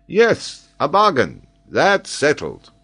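high, loud, emphatic confirmation; cocksure, ringing assent; eager agreement. Acted. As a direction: seal the bet excitedly. Geographer eagerly seals the wager.

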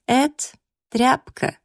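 high-pitched, haughty contempt; disdainful rival with pauses between words. Acted. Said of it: "высокомерно повела бровью она".

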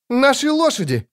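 high urgent shout. A narrator's reading. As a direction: yell for the horses. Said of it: "крикнула Мильва".

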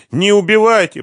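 high, loud wail of fear; desperate, terrified wail; moaning plea. A narrator's reading. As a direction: beg for mercy in a high moan. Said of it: "простонал он".

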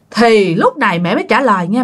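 plain reading voice

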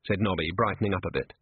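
usual speaking voice

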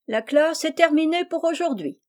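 lightly voiced self-important announcement; imposing teacher tone. A narrator's reading. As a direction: dismiss the class grandly, playing teacher. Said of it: "de son ton le plus imposant".

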